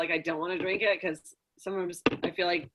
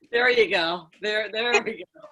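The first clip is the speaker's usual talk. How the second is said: ordinary talk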